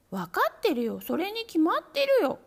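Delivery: high, loud kitten chorus. A narrator's reading. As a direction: shout it back at once. Kittens answering.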